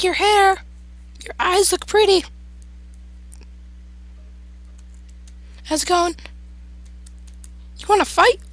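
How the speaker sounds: very high falsetto taunting; mock-defiant falsetto squeak; squeaky put-on voice. Player taunting the monster locked outside, high squeaky put-on voice.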